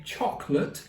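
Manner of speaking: chocolate in a posh British accent. Shown as a demonstration. Vlogger mocking British people who say chocolate, posh British accent. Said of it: "or posh".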